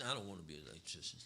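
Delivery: low, quiet, fast mumbled refusal; sulky youth. Acted. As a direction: mumble it like a kid who won't commit. Acted reluctant youth answers.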